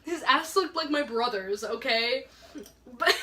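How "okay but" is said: ordinary talk, nothing marked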